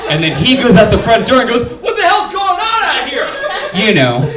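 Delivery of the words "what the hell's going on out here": high, put-on innocent neighbor voice; clueless neighbor. Dad playing dumb to the neighbors, feigned innocent call. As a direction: act like you had nothing to do with it. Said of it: "like a clueless neighbor".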